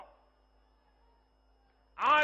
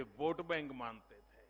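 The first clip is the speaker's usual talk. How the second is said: quiet echo; 13 semitones lower, 13 dB quieter than usual. Acted. Repeated phrase as a soft echo.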